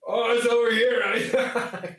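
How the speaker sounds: laughing. Real, not acted. Laughing punchline about humor.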